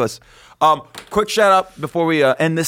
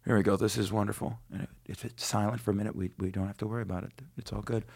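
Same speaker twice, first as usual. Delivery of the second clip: quiet aside; 7 semitones lower, 15 dB quieter than usual. Real, not acted. Quiet aside before reading.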